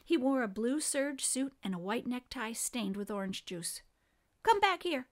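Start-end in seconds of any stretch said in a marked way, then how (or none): 4.4-5.1 put-on high, loud call from a bossy boy; higher, louder, insistent shout. A narrator's reading. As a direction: call someone back sharply. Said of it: "he called to Stuart".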